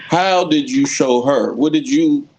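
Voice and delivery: ordinary talk, nothing marked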